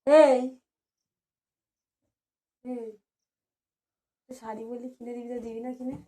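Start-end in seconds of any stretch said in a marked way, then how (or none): 0.1-2.9 sharp loud call for attention; a loud hey to get him to look up. Real, not acted. Wife calls out loudly to get husband's attention. Calling out to partner.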